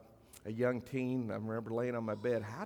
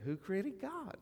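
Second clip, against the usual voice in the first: much higher imitated little-girl question; sweet young girl; 10 semitones higher, 4 dB quieter, slower than usual. Pastor showing how the girl asked him, high, sweet child voice. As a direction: pitch it right up like a sweetly puzzled child. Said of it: "That's how she said it".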